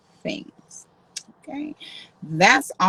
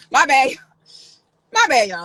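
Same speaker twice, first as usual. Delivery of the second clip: louder, laughing my bad; laughing apology; 5 semitones higher, 8 dB louder than usual. Real, not acted. Streamer laughing off her outburst to viewers. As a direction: laugh it off to chat.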